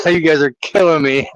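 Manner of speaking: real laughter around the words, pitch lifted; laughing. Real, not acted. Host reacting to the tattoo dare, laughing. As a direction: say it while cracking up.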